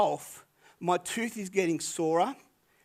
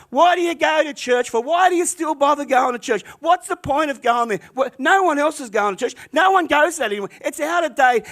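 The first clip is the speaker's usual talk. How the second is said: much higher, louder, relentless mocking questions; ragging on her; 10 semitones higher, 9 dB louder than usual. Townsfolk mocking Peggy for going to church, loud and high. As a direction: badger her loudly with fake disbelief. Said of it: "they're just ragging on her".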